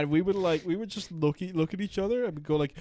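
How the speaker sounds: ordinary delivery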